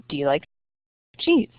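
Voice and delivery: usual speaking voice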